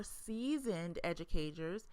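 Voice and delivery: usual speaking voice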